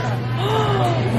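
sharp high gasp of surprise; surprised gasp. Real, not acted. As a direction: catch your breath in surprise. Learns she must order ahead.

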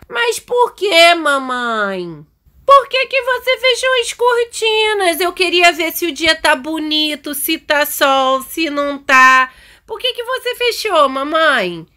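put-on high, drawn-out child whine; pleading kid voice, slow stretched words; complaining little daughter. Voices the daughter complaining about curtains.

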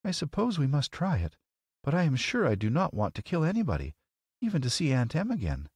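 put-on high-pitched, reluctant girl's voice; reluctant young girl. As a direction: agree unwillingly as a little girl. Dorothy reluctantly agrees.

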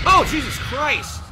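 real jolt of fright, loud, high and drawn out; startled shout. Real, not acted. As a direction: yelp at the jump scare. Jump scare in horror game.

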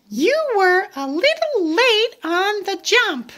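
high little pig voice; Piggy telling Gerald he jumped late, high voice. Acted. Piggy tells Gerald he's late.

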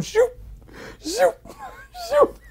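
high, sudden whoosh sounds with gaps; imitated whooshing. God taking sins away as they are confessed, whooshed. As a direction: make each one a quick zip upward.

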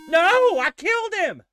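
high, loud victory yell; triumphant shout. Real, not acted. Gamer shouting at a surprise win. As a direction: shout in delighted disbelief. Winning a fight.

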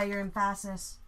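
"emphasis" with the middle syllable stressed; misplaced stress. Shown as a demonstration. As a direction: say em-FASS-is for "emphasis", as a joke. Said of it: "emphasis on the wrong syllable".

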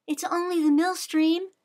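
calm a startled horse gently; gentle. A narrator's reading. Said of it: "Sybil said gently".

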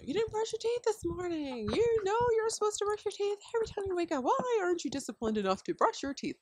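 high, fast nagging mom voice; hammed-up exasperated mom lecturing; mock scolding parent. Mom nagging her kids about brushing teeth, high mock scolding.

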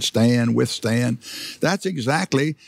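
ordinary delivery

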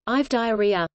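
ordinary talk

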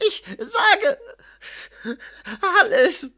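high, broken surrender, gasping pauses; groaning in pain. A narrator's reading. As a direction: force out each word through pain. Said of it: "ächzte das bedauernswerte Opfer". (ächzend und stockend, vor Schmerz)

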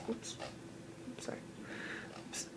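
real whisper, breathy and quick; whispered apology. Real, not acted. Vlogger apologizing to viewers after an oops, whispered. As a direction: murmur sorry under your breath.

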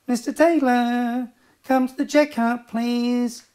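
high, drawn-out checkout call; supermarket announcer. Retold or imitated. Supermarket worker calling someone to the checkout, high put-on announcement. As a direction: parody a store tannoy voice, pitched well up.

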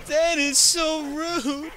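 loud, very high drawn-out fake wail; mock bawling. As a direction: wail it like a hammed-up sobbing teacher. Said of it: "Miss Infantino just starts bawling".

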